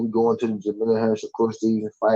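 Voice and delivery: ordinary delivery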